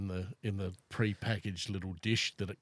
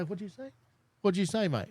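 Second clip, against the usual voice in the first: higher-pitched challenge to a pet; mock questioning; 10 semitones higher than usual. Owner answering his meowing cat, mock querying. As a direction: ask the cat back, pitched up.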